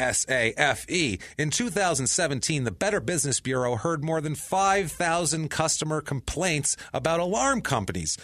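lively scripted ad delivery; ad read. Real, not acted. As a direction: sell it with emphatic podcast-host energy. Host reads sponsor ad.

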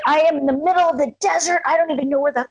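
hammed-up angry daughter on the phone; retold flipping out. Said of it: "Like, flip".

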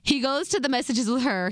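ordinary delivery

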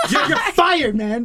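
high, slow, laughing fake scolding; laughing mock firing. Real, not acted. Joking to his cohost that he's fired, laughing. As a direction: fire him through laughter, joking.

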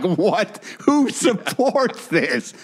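incredulous high-pitched squawk; exasperated, pitch climbing sky-high; outraged disbelief. Retold or imitated. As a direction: build from baffled to squealing disbelief. Outraged reaction to the retold demand.

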